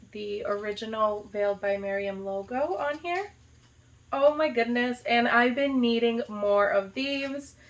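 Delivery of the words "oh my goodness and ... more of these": usual speaking voice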